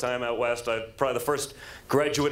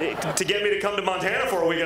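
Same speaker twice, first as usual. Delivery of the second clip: ordinary talk, nothing marked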